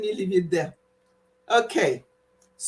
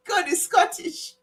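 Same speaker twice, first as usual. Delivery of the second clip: normal talking voice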